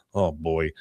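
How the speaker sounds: ordinary delivery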